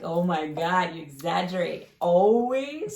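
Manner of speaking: loud, put-on exasperated voice rising on always; exaggerated scoffing imitation; mocking exasperation. Shown as a demonstration. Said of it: "And you say".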